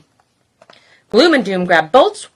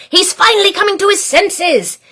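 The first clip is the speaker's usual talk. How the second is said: 1 gleeful Grouch; 9 semitones higher than usual. Acted.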